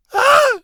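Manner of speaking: high piercing shriek; terrified scream. Acted. Serena sees the vampire, screams in terror. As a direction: scream in sudden fright. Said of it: "Serena screamed".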